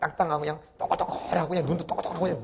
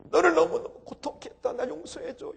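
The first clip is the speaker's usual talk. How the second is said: high, pleading mother; tearful plea; 13 semitones higher than usual. Retold or imitated. Mother kneeling before her child, tearful plea. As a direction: beg forgiveness, close to tears. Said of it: "울면서 이제".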